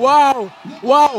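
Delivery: loud amazed cries; excited waouh. Real, not acted. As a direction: marvel out loud at the knockout. Co-commentator reacts to knockout.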